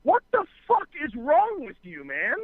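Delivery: high-pitched, incredulous retold yell; exasperated outburst. Gun holder confronting the kid after the standoff, exasperated retold yell. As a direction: act out an exasperated demand, voice shooting up.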